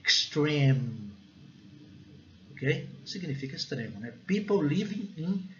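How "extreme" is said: EXTREME drawn out, ending in a long hummed M; closed-mouth final M. Shown as a demonstration. Teacher showing the classic M, lips closed and held. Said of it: "fechando a boca".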